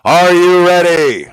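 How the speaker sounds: loud, slow rallying cry; hype shout. Acted. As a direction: rouse the crowd. Hype question to the crowd.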